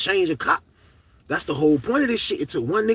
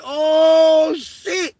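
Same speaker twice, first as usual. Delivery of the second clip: higher, loud stunned exclaim; amazed shout; 9 semitones higher, 5 dB louder, much slower than usual. Retold or imitated. Learning old head has the Lala, amazed exclaim. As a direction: let it burst out in disbelief. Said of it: "I was like".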